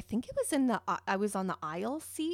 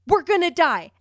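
blurted out much louder and higher; a sudden terrified cry on a bouncing plane; panicked outburst; 6 semitones higher, 12 dB louder than usual. Retold or imitated. To the crying boy across the aisle, a loud panicked cry. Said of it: "I said out loud".

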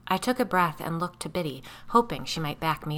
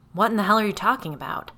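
loud, fast, higher furious demand; livid demand; 5 semitones higher, 7 dB louder, faster than usual. Acted. Frank confronting the narrator, loud and fast with fury. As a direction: spit it out angry and scared at once. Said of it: "Frank demanded ... livid and terrified".

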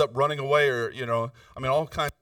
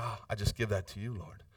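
murmured prayer; 6 semitones lower, 8 dB quieter than usual. Retold or imitated. Retold surrender prayer.

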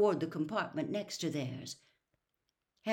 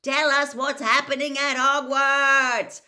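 loud, higher bellow; 9 semitones higher, 13 dB louder than usual. Acted. Fred yells from the moving train, a loud bellow. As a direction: yell it out the window. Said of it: "Fred bellowed out of the window".

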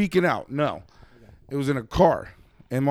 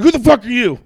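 angry yell; 8 semitones higher, 6 dB louder than usual. Retold or imitated. Angry quote of neighbor confrontation.

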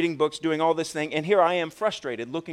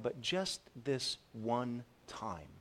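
quiet drawn-out self-excuse, easing into it; soft, slow tempting excuse; 9 dB quieter, much slower than usual. Retold or imitated. Preacher voicing a congregant's excuse, soft and slow. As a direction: talk yourself into it, softly and slowly. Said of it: "Another excuse is".

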